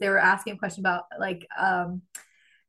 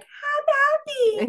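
very high drawn-out squeal; mock overjoyed; much slower than usual. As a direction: stretch it into a gleeful sugar-baby squeal. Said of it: "he sends the money and I'm like".

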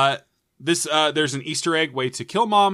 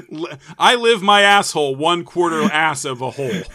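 laughing; 8 dB louder than usual. Real, not acted. Cracking up mid catchphrase.

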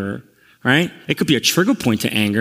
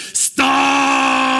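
Hulk outburst; 11 semitones higher than usual. Retold or imitated. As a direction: explode into one long re-enacted shout. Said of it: "I became the Hulk".

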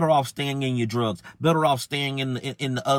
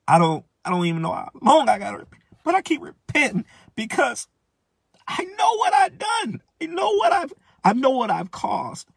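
anguished shout; 11 semitones higher than usual. Real, not acted. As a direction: cry out what you've done, voice cracking. Shouting his remorse.

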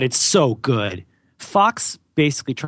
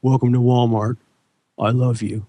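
movie greeter. Retold or imitated. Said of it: "He goes".

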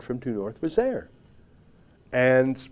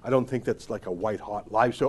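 ordinary talk

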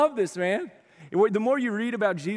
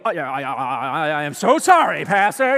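flustered golf buddy; 5 semitones lower, 5 dB louder than usual. Retold or imitated. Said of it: "immediately they go".

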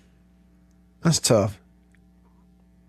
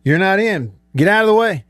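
coach yelling; 6 semitones higher, 3 dB louder than usual. Retold or imitated. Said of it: "coaches are probably gonna get tired of me and say".

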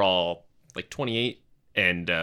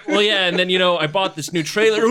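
high, loud imitation of strained small talk; brother-in-law chatting through nausea; 12 semitones higher, 10 dB louder than usual. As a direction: keep chatting loudly while fighting a gag. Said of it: "trying to talk to you and cleaning up".

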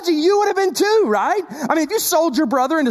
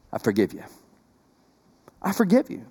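tender forgiveness; 9 semitones lower, 5 dB quieter, faster than usual. Retold or imitated. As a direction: let the brothers hear mercy. Joseph forgiving brothers, voiced.

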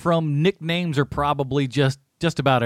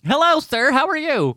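mock polite voice; 12 semitones higher, 3 dB louder than usual. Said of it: "You should have just said".

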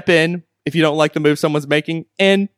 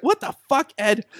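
stunned outburst; 6 semitones higher, 4 dB quieter than usual. Real, not acted. Breaks off reading in disbelief.

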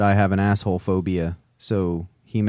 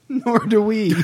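laughing; 6 semitones higher, slower than usual. Real, not acted. Laughs at confused co-host.

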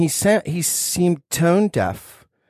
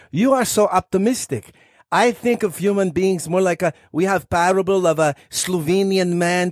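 put-on Slovenian accent, pitched higher; 5 semitones higher than usual. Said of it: "your Slovenian accent is stunning".

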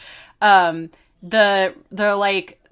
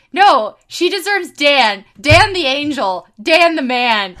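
higher, louder, slow emphatic praise; fangirling over Dan; 5 semitones higher, 7 dB louder, slower than usual. Retold or imitated. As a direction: gush about Dan the angel. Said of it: "I'm just like".

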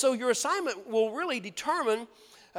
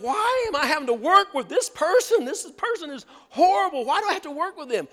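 exasperated complaint; 6 semitones higher, 7 dB louder than usual. Retold or imitated. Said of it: "you think".

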